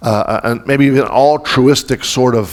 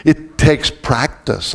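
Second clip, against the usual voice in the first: drawn out slowly, each word weighted; slow it down so it lands; slow emphasis; much slower than usual. Shown as a demonstration. Pastor stressing a point to the congregation, slow and deliberate. Repeats key line, slow then hushed.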